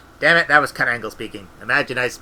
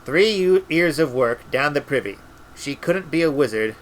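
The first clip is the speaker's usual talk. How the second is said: usual speaking voice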